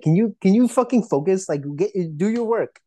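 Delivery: high-pitched exasperated scolding. Retold or imitated. Voicing exasperated crew scolding director.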